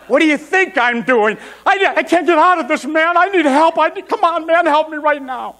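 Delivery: exasperated outburst rising into high panic; acted desperate plea, pitch way up; frantic pleading. Trapped man begging his friend for help, frantic.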